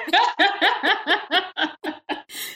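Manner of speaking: long real laugh trailing off; falling laugh. Real, not acted. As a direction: laugh it out and let it fade. Host laughs at guest's story.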